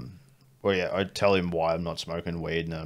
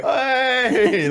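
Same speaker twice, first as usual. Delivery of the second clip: high loud hey; shouted greeting; 7 dB louder than usual. Retold or imitated. Worker answering the call across the crowd, high shout. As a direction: yell back hey. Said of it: "You're just like".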